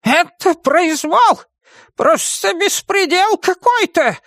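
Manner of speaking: very high, drawn-out witch's tirade; raging witch. A narrator's reading. Witch storms at the lawless outrage, raging. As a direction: rage in a high-pitched witch voice. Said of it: "бушевала ведьма".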